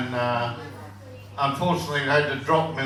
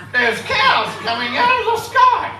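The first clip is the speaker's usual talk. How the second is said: much higher and louder, alarmed; panicked high shout; 7 dB louder than usual. Acted. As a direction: yell it in high-pitched disbelief.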